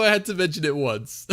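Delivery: usual speaking voice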